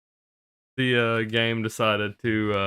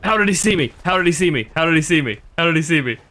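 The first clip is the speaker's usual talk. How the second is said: panicking gamer; 5 semitones higher, faster than usual. Real, not acted. Sudden ambush in game.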